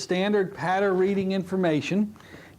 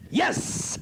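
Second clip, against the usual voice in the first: excited yes; 11 semitones higher than usual. Retold or imitated. Excited reaction outside door.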